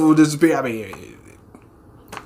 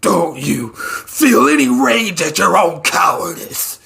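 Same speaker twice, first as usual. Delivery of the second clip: strained mock rage; 2 semitones higher, slower than usual. Sweet Mask raging at Darkshine.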